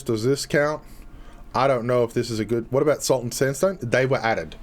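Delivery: ordinary delivery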